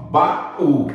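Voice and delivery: baú split as ba... Ú; syllable by syllable. Shown as a demonstration. Teacher shows the tonic U, baú in two beats. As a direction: sound out baú with the ú on its own. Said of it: "U na sílaba tônica".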